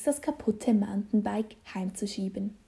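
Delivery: ordinary delivery, nothing marked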